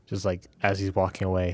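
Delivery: ordinary delivery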